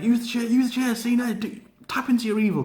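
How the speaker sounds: high, coaxing taunt; taunting Bray. Retold or imitated. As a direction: egg him on to use the chair. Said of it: "he's like".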